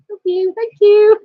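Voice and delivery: delighted squeaky thanks to husband; squeaky thank you. Real, not acted. Thanking husband for coffee, rising.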